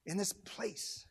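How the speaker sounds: very soft, solemn delivery; hushed invitation. Real, not acted. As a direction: invite gently, almost under the breath. First take, before the urging.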